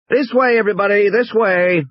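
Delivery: tour guide calling the group along. Acted. Guide herding tourists to the cars, calling out.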